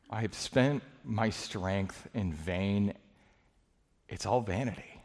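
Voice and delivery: slow, quiet, worn-out voice; weary lament. Acted. Servant before God, slowly and quietly spent. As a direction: sigh out the defeat, slowly. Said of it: "and he says".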